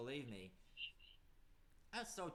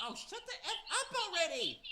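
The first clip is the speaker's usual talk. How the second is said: high, loud fed-up snap; angry outburst; 12 semitones higher, 5 dB louder than usual. Acted. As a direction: explode at her in frustration. Jill snaps at Cindy.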